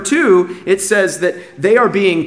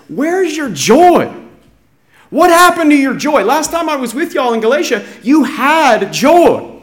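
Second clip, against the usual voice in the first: impassioned challenge; 10 semitones higher, 5 dB louder than usual. Acted. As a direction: voice Paul's urgent, high-pitched challenge to his flock. Preacher voices Paul's challenge to Galatians.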